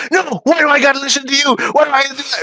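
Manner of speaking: high, fast squeaky whine; mock whiny refusal. Mocking Danny's stubborn reply, fast high whine. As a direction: rattle off a bratty whine.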